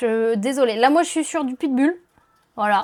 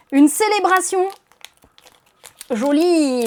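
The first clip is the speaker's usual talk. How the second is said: excited; 4 semitones higher, 5 dB louder, slower than usual. Real, not acted. Excited at a good pull.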